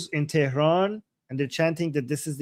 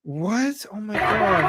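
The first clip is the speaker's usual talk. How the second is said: higher, startled disbelief; shocked surprise; 5 semitones higher, slower than usual. Real, not acted. Host stunned by a protest video, startled disbelief. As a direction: gasp it out in shock.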